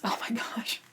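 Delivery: whispered dismay. Real, not acted. Dreading Sturm's death.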